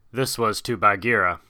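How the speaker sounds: plain reading voice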